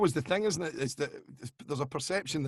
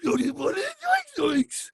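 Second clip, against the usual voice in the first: a much higher squeaky cartoon voice; high cartoon squeak; 14 semitones higher, slower than usual. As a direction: squeak it like a frightened cartoon character. Continuing cartoon voice bit.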